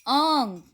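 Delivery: आंग said with a long nasal ang; nasal sound demo. Shown as a demonstration. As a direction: hold the आंग nasal, drawn out. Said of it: "बिंदु की ध्वनि कैसे होती है?". (आंग को नाक से लंबा खींचकर बोलिए)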